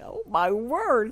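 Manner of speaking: slow, drawn-out mock dismay; retold reaction, gently appalled; mildly shocked Southerner. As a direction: stretch it out like a taken-aback Southerner. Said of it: "And I was like".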